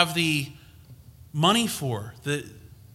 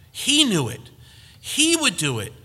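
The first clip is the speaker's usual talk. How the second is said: building, much higher and louder by the end; fervent sermon climax, rising to a shout; rising preacher fervor; 11 semitones higher, 7 dB louder than usual. Real, not acted. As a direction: preach it up to a ringing peak.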